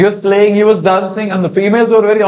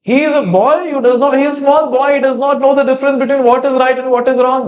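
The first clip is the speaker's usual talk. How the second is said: put-on high, earnest pleading for the boy; high earnest plea; 7 semitones higher, faster than usual. Brahmana pleading with the father, high and earnest. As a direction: beg the father to spare his small son.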